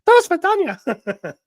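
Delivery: very high, loud put-on Russian goodbye; slow falsetto mock Russian accent; Dana impersonation. Dana saying goodbye for the chat, in a high, loud Russian accent. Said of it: "my Dana impersonation".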